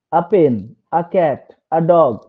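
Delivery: "A" said as uh before each noun; slow demo with "a" as uh in "A pen, a cat, a dog"; weak article uh. Shown as a demonstration. Teacher showing counting with the article, "a" pronounced uh. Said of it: "ওয়ার্ডটার উপর জোর দিচ্ছি না".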